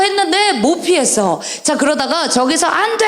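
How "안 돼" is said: mock cry. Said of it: "어떤 아저씨가".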